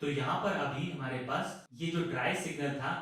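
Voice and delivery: soft, distant voice in reverb. Shown as a demonstration. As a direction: speak quietly through an added reverb. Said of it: "एक reverb add की है".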